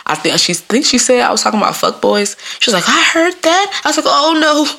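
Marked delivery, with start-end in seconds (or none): 2.9-3.8 higher-pitched take on a grandmother; lightly voiced nana, pitched up; imitated nana. Nana telling granddaughter she heard it, imitated higher voice. Nana caught her talk, acted out. 4.1-4.7 higher, playful "caught out" panic; mock dismay. Granddaughter caught by nana talking dirty, mock dismay.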